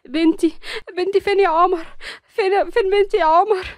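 frightened mother searching for her daughter; anxious mother. A narrator's reading. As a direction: beg to know where she is. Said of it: "داخلة في اللحظة دي بقلق".

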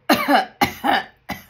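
loud hacking cough; throat-clearing cough. Real, not acted. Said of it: "Let me clear my throat".

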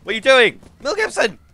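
high, loud alarmed yell; panicked high shouting. Real, not acted. Yelling at Mel Gibson.

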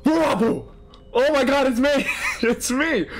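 high-pitched delighted outcry; excited recognition. Real, not acted. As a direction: cry out amazed, breaking into a laugh. Recognizes own character.

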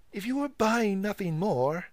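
put-on high, cheeky serving boy; impudent. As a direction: sass the customer. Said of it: "with much impudence".

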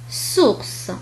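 "سورس" with voiceless s at both ends; S as seen. Shown as a demonstration. Teacher showing the first case, plain s in "سورس". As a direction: say "سورس" with ordinary hissed s. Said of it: "ينطق بطريقة عادية كحرف سين".

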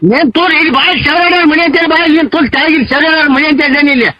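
ordinary delivery

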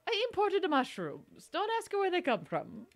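high, mock Italian plumber voice; Mario. Streamer voices retired plumber on his ingredients, crappy mock Mario. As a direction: play a shady pizzeria owner in bad Italian-American. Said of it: "look at retired Mario".

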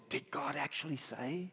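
sly hushed devil voice; devil's question. Acted. Preacher voicing the devil tempting Eve, sly hush. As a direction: whisper it like the tempting serpent. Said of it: "the devil came along and said".

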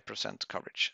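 normal talking voice

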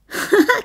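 high mocking snicker; sneering snicker. Camille snickers at the fearful Thérèse. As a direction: snicker at her fear. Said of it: "Les ricanements de ce pauvre homme".

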